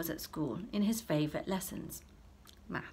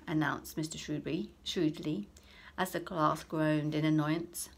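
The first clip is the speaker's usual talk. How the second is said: usual speaking voice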